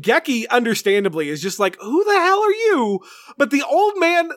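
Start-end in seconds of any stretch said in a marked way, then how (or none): 1.8-3.0 mock-outraged question, pitched way up; playful mock yell of who are you; indignant demand. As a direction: hurl it at a stranger who appeared from nowhere. Geki confronts the strange old man.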